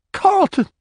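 high, loud desperate wail of a name; anguished cry. Acted. June cries out to her dying husband, anguished. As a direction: call him back through tears.

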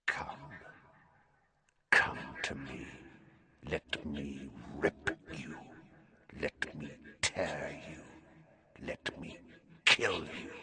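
a hidden monster hissing murderous promises; ice-cold venomous voice. Acted. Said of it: "A voice to chill the bone marrow ... ice-cold venom".

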